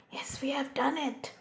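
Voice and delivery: soft whispered triumph; whispering. Real, not acted. Whispered celebration.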